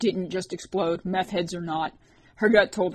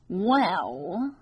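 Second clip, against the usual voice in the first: sly, nasal stranger, pitched up; dark nasal snicker. A narrator's reading. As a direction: sneer it through the nose. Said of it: "a dark, nasally voice".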